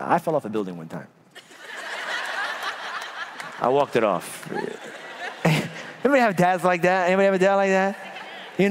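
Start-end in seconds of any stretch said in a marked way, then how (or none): 1.7-3.6 a sudden high burst of laughter. Real, not acted. Preacher laughs at falling off a building, high burst of laughter. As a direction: laugh at the absurd memory.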